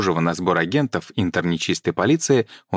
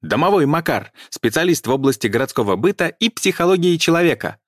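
barked out; 10 semitones higher than usual. A narrator's reading. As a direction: bark it out to seem important. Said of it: "гаркнул".

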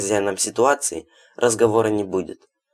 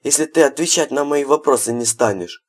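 mocking threat; 7 semitones higher, 4 dB louder than usual. A narrator's reading. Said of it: "в тон ему ответил Хладов".

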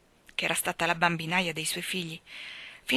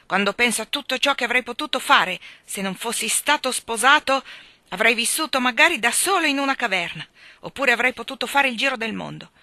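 loud, higher self-pitying complaint; grumbling rant; 5 semitones higher, 7 dB louder than usual. A narrator's reading. (lamentela stizzita e forte)

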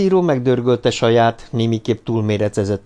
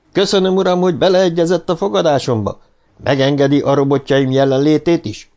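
put-on stiff formal young man; 6 semitones higher, 4 dB louder than usual. A narrator's reading. Young visitor formally thanks Amadiro.

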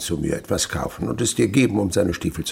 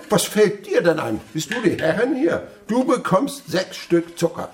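sharp, higher scolding of the maid; indignant rebuke; 9 semitones higher than usual. Acted. Miser scolding his maid over sugar, indignant. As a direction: put her firmly in her place.

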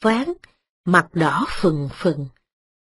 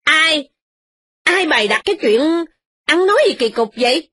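high, sharp scolding matriarch; frowning old mistress; 7 semitones higher than usual. A narrator's reading. Old mistress frowning at her daughter-in-law's story. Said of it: "Bà cai chau mày mà nói rằng".

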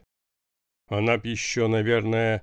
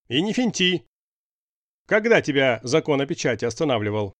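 irritated boss, higher and louder; displeased; 4 semitones higher, 4 dB louder than usual. A narrator's reading. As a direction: scold him with rising annoyance. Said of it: "Голос главного сделался недовольным".

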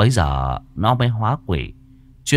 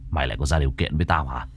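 lower, fast, quieter threat; angry master; 7 semitones lower, 4 dB quieter, much faster than usual. Acted. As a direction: spit it out fast in cold anger. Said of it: "máu đã dồn lên não". (giọng thầy trầm, nhanh, giận dữ)

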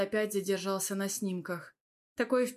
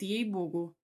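usual speaking voice

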